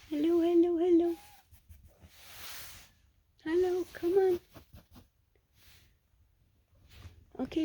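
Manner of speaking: high, loud coaxing coo. Real, not acted. Coaxing the kittens to come in a high, loud voice. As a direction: coax the kittens out, sing-song and high.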